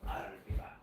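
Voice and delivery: breathy, low whisper "I'll be back"; whispered Terminator line. Pretend. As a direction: whisper it like a movie threat.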